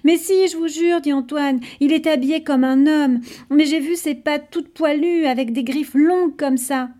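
insistent pleading. Acted. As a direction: swear it's true, excited. Antoine insisting to class.

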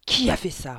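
furious headmistress roar. A narrator's reading. Headmistress roaring at the pupils, furious shout. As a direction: bellow it at the class. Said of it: "rugit-elle".

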